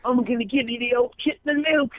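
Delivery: mock British accent, odd threat; mock British gangster. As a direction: parody a London gang threat. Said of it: "Over there".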